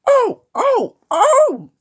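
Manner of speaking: very high, loud squealing wail; protesting trucks. A narrator's reading. Trucks as James backed down on them, protesting.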